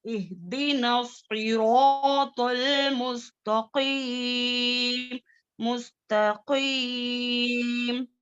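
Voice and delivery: very slow, drawn-out Quran recitation. Shown as a demonstration. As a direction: chant it slowly and fully. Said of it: "थोड़ा बुलंद कर लेंगे".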